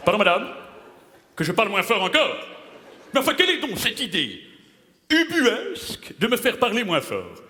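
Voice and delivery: pompous outrage climbing high; indignant dramatist. Acted. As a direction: huff up like an offended playwright. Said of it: "J'en chie les ronds de chapeau avec Madeleine".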